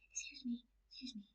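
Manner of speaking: tiny high, hushed attempt to get attention; timid. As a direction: call out too softly to be heard. Acting out timid call to waiter.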